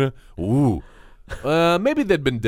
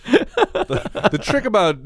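ordinary talk

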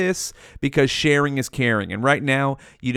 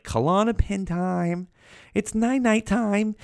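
put-on higher, slow babyish sing-song; sing-song night-night; 6 semitones higher, slower than usual. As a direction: coo it slowly like a lullaby. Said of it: "I only did that that many times".

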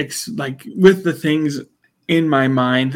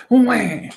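imitated baby crying, pitched up; mock baby cry; 9 semitones higher than usual. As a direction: put on a baby's "wah". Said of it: "The baby cries like this".

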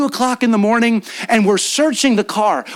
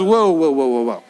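mock startled whoa; 7 semitones lower than usual. Chris's wife reacts to story.

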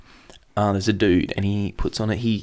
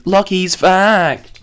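slow, high drawn-out title call; announcer voice; 9 semitones higher, 3 dB louder, much slower than usual. Pretend. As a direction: announce it like a show segment.

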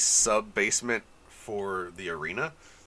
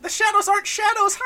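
tiny, squeaky, much higher cartoon mouse voice; Mickey Mouse voice; 7 dB louder than usual. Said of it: "an adorable, harmless Mickey Mouse voice".

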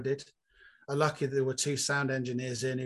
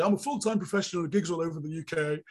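mock boastful comic; 3 semitones higher than usual. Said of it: "a lot of people do".

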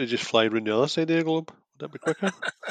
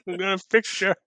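lightly voiced mock vow; soft mock vow to a horse; mock vet line; 5 semitones higher, 4 dB quieter than usual. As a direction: parrot the hero's promise. Repeating the line mockingly.

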